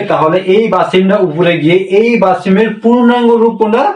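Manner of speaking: ordinary delivery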